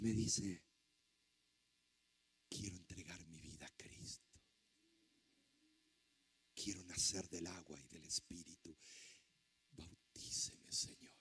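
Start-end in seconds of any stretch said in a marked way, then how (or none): 2.5-11.1 near-whisper, low and very soft; hushed, earnest plea. Retold or imitated. Man asking the preacher to baptise him, hushed plea. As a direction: murmur it privately, like a humble confession.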